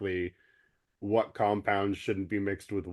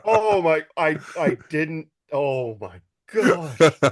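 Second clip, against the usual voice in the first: shocked; 8 semitones higher, 7 dB louder, slower than usual. Real, not acted. Reacting to dark joke.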